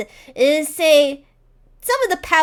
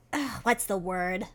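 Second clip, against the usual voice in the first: ordinary talk, nothing marked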